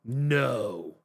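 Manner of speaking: low, drawn-in little refusal; stubborn reluctant protagonist. Acted. Protagonist refusing while the world burns, low and stubborn. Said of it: "the whole time they're just like".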